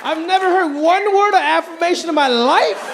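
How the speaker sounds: hammed-up indignant outburst. Outraged rant at the idea.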